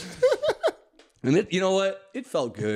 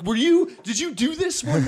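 a touch higher, excited asking; eager fan; 10 semitones higher than usual. Pretend. Comic imagining meeting the heckler again, eager. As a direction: excitedly greet him like a fan. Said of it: "approach him and be like".

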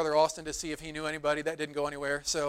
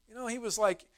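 ordinary talk, nothing marked

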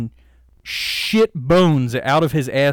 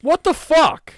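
exasperated outburst; 13 semitones higher, 6 dB louder than usual. Real, not acted. Scolding about the mic.

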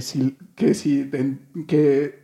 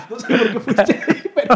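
laughing; 4 semitones higher, 5 dB louder than usual. Real, not acted. Laughing after teasing remark.

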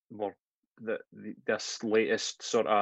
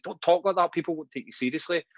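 higher-pitched retold telling-off; mock scolding parent; 6 semitones higher than usual. Dad correcting his child's speech, mock scolding.

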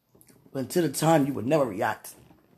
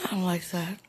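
whispered aside; 5 dB quieter than usual. Real, not acted. Praying on a recording, whispers an aside. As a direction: breathe it under your breath. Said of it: "whispered aside".

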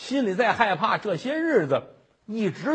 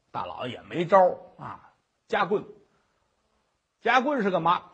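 usual speaking voice

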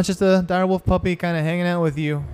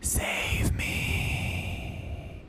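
eerie whispered plea; female whisper; 11 dB quieter than usual. Acted. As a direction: whisper it like a ghostly girl. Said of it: "in a female voice".

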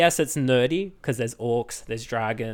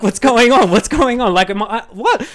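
mock panicked auntie; 9 semitones higher, 9 dB louder than usual. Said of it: "She's like".

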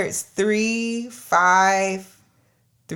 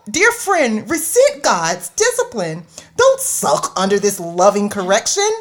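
theatrical reading; 3 semitones higher than usual. Acted. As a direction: read it like a grand stage performance. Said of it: "The theatrics in that voice".

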